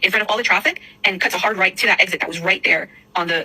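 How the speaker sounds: ordinary talk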